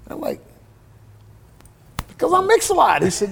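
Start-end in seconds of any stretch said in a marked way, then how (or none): 2.1-3.0 incredulous. Retold or imitated. Naive answer to Rick Rubin.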